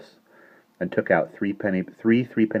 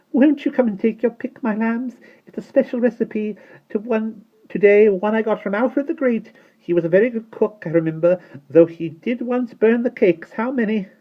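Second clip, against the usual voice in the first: sweet coaxing voice; 6 dB louder than usual. A narrator's reading. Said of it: "beckoned so sweetly".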